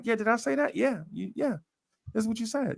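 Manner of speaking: lightly voiced impressed friend; mock friend voice. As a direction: play the friend checking the notes. Friend reading notes, retold.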